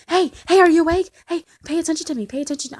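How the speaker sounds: loud, high-pitched badgering; mock pestering. Boyfriend hounding sleeping girlfriend to wake up, loud mock pestering. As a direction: call out insistently to rouse someone. Acts out hounding her awake.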